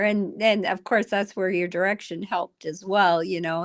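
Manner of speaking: normal talking voice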